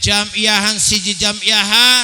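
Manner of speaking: loud, high call-and-response lead; rallying preacher. Real, not acted. Preacher rallying Mangunjaya villagers to pray together, high loud rallying call. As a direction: belt the slogan out for the crowd to answer.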